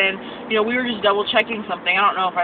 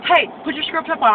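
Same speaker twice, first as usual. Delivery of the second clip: snapping teacher; 8 semitones higher, 3 dB louder than usual. Retold or imitated. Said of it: "she started snapping at me".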